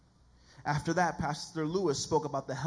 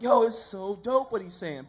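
loud, higher-pitched hyped reaction; impressed; 4 semitones higher, 7 dB louder than usual. Retold or imitated. Preacher recalling his reaction to the pastor, loud and impressed. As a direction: play up being wowed.

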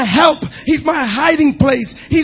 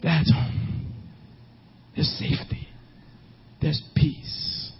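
soft whispered words of safety; 10 semitones lower, 12 dB quieter than usual. Real, not acted. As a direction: whisper it like a secret comfort. Tender picture of a safe home.

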